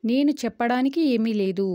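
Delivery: normal talking voice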